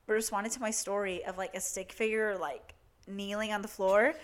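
ordinary delivery

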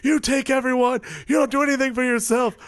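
high, loud mock rant at God; furious shout to the heavens, imitated; mock scream. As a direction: scream it up at the sky, hammed-up. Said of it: "like, screaming".

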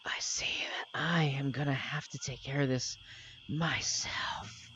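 eerie unvoiced whisper; a spirit voice in the party's heads, whispering; mystical whisper. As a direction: breathe it like a hidden spirit. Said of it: "mystical voice you've been hearing".

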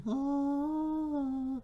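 long, high held "ah"; mock churchy note. Preacher imitating what religious people prefer, high held note. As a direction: hold one high, steady note in mockery. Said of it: "They rather you be".

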